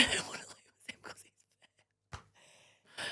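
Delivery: slow, breathy whisper of a private worry; whispered thought. Retold or imitated. Confessing to her friend what she thought, a slow whisper. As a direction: whisper it slowly, as a secret fear.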